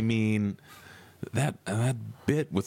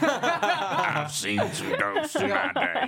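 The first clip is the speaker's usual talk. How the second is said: slow, high, laughing Sling Blade drawl; much slower than usual. Acted. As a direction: drag it out like Karl from Sling Blade. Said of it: "now I'm almost going Sling Blade".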